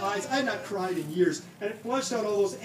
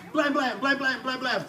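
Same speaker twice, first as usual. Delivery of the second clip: gunshot noises; 4 semitones higher, 5 dB louder than usual. Retold or imitated. Imitated six-shooter gunshots.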